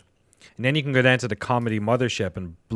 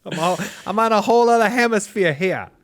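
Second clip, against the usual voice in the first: thick Boston accent, pitched up; 8 semitones higher than usual. Shown as a demonstration. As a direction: drop the r's like a Bostonian. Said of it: "I was doing a Boston accent".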